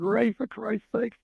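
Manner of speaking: imitating a concerned yell; mild retold yell. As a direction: call out in exasperated protest, lightly acted. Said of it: "And I yell out".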